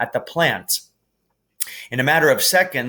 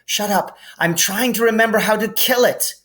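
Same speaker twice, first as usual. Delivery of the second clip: put-on higher, louder girl snapping; a touch of sharp girl; Hermione snapping; 11 semitones higher, 4 dB louder than usual. A narrator's reading. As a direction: snap back while thinking.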